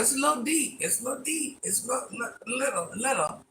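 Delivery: high, light little voice; playful squeaky denial. Pretend. Playfully answers that voice isn't deep.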